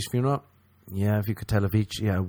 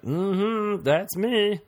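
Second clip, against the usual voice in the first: much higher, smug self-satisfied voice; high mock-flattered purr; smug women; 11 semitones higher, much slower than usual. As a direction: preen like you are the queen. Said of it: "And they were like".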